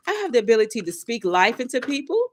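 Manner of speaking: normal talking voice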